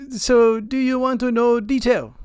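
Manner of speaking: high-pitched imitated Indian caller accent. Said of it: "eight different accents".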